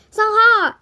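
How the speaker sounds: loud high call of a name; shouted name. A narrator's reading. Friend spots Sungha in the fire, loud call. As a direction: call out across the burning room.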